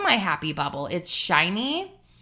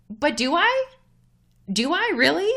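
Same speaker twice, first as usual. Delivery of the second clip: high, questioning comeback; retold doubtful retort; skeptical questioning; 7 semitones higher than usual. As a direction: push back with a raised, doubting question. Acts out her doubting reply.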